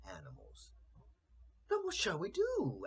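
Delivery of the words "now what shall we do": high, fretful cat voice; a small cat fretting aloud; cat asks. A narrator's reading. Cat asking the others what to do, high and worried. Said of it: "asked the cat".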